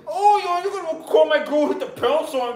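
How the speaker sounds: put-on high, loud whiny kid, laughing; Morty-style kid. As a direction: whine it like a squeaky teen. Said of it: "Some poor ass kid's gonna tweet".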